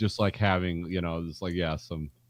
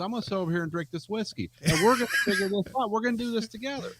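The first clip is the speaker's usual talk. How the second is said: high, hammed-up good-ol'-boy voice; mock Southern engineer; 10 semitones higher than usual. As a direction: play the charming Alabama guy talking his coworker round. Acting out Alabama engineer's pitch.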